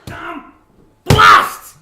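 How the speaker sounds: loud, low Scottish curse; a burst of Scottish swearing; Scottish accent. Acted. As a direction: spit it out in a broad Scottish accent. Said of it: "That sounded very Scottish".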